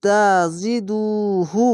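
slow chanted tilawat, vowels drawn out; Quran recitation. Shown as a demonstration. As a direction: intone the whole word slowly. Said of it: "পেশের পরে wow সাকিন এক আলিফ টেনে পড়বেন".

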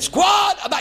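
high, loud parade-ground call; drill command. Retold or imitated. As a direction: shout the order across the square.

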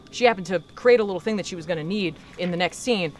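plain reading voice

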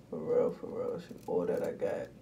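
hushed whispered aside; whispered for real. Real, not acted. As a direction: drop to a whisper, confiding. Intimate aside to viewers.

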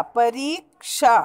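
परीक्षा slow, with a long "ree"; correct pronunciation. Shown as a demonstration. Teacher models the spelling, drawn-out परीक्षा. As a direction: stretch the middle of परीक्षा, pa-reee-ksha.

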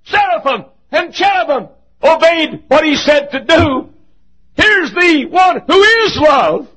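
high, loud, slow preaching cry; full-voiced pulpit cry; preaching shout. Real, not acted. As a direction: lift it to the rafters.

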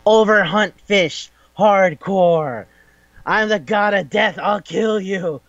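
a lightly voiced high god of death; hint of a mock god voice. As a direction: toss off a hammed-up deathly threat. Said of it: "some dude walking around ... goes like".

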